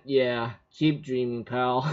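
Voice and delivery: low quiet mocking aside; sarcastic. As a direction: mutter it with dry scorn. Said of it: "I think in my mind".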